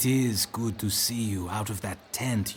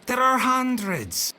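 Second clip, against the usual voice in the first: exasperated disbelief; 12 semitones higher, 4 dB louder than usual. Acted. Servant objects in disbelief.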